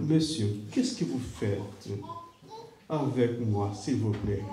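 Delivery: a scared passenger begging; hushed, frightened plea; timid fear. Retold or imitated. Third fearful question to driver.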